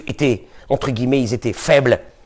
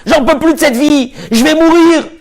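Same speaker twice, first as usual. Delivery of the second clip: Rachel pleading; 7 semitones higher than usual. Acted. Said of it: "Il s'énerve sur elle".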